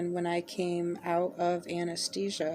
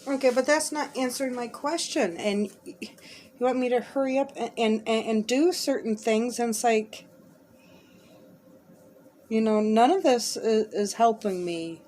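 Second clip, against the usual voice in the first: frustrated; 4 semitones higher, 4 dB louder than usual. Real, not acted. Frustrated plea to unseen listener.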